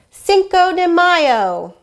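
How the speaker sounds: loud, high, drawn-out cheer; festive exclamation. A narrator's reading. Reader announces the holiday in the story, festive cry. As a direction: call it out slowly like a fiesta cry.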